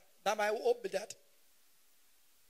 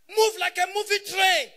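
loud, high shouted order; comic command; 8 semitones higher, 9 dB louder than usual. Pretend. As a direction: order it like a drill sergeant.

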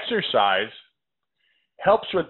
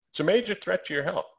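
usual speaking voice

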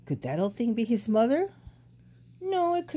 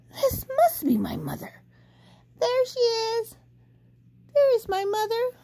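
high, rising joy; hopeful baby bird. A narrator's reading. Baby bird finds the big thing, high and overjoyed. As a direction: sure he found her at last.

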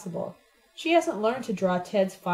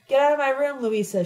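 loud, high, vicious snap; vicious outburst; 6 semitones higher, 5 dB louder than usual. A narrator's reading. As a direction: lash out at her, sharply and loud. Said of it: "she hissed viciously".

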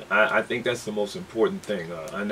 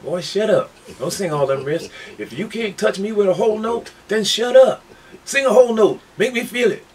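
high, loud, exasperated father impression; hammed-up parental telling-off; scolding dad; 11 semitones higher, 7 dB louder than usual. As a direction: bawl out your boy like a fed-up dad. Acts out dad scolding him.

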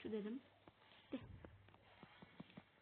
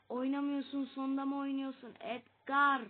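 1 angry complaint; 2 semitones higher, 10 dB louder than usual. Real, not acted. Yelling at the game.

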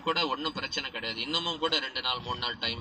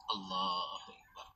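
slow, soft, awed Allahu akbar; hushed awe; 7 semitones lower, 12 dB quieter, much slower than usual. Real, not acted. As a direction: breathe it out, overcome. Moved exclamation after child's answer.

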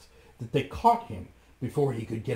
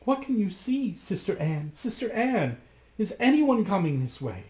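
higher anxious call; 8 semitones higher than usual. A narrator's reading. Wife calling up to Sister Anne. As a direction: call up the tower urgently. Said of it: "called out".

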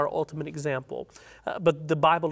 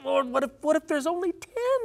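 much higher, climbing desperate plea; Abraham's last plea, high and desperate; humble desperate plea; 9 semitones higher than usual. Acted. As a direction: beg the Lord for the last ten, pitch soaring. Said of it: "you could just sense the humility from him here".

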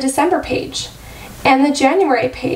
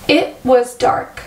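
plain reading voice

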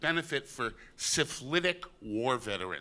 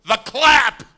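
much higher, loud shouted ad-lib; shouted punchline; 10 semitones higher, 14 dB louder than usual. Retold or imitated. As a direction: blurt out the dirty joke. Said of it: "He goes".

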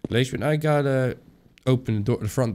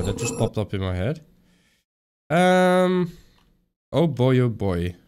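ordinary delivery

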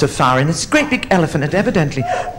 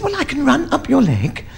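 lightly voiced mouse, pitched up; little mouse; 8 semitones higher than usual. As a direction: explain it as a small, high-voiced mouse. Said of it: "He said".

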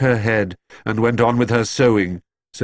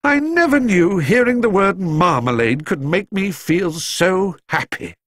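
tearful relief; 10 semitones higher than usual. A narrator's reading. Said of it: "wanted to laugh or cry".

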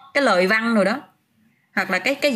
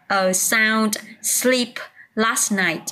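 drawn-out, careful a sound sleep; teacher slowly repeats the phrase for students; very slow model phrase; much slower than usual. Shown as a demonstration. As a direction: stretch each word of a sound sleep for learners. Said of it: "Từ have chuyển thành quá khứ".

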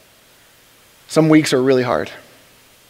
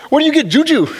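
much higher, baffled question; incredulous; 11 semitones higher than usual. Retold or imitated. Mocking a friend's juju post, high incredulous question. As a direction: ask in mock disbelief, pitched way up.

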